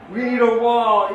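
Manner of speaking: loud, high mock insistence; mock demand. Israelis voiced demanding a wall, loud mock demand.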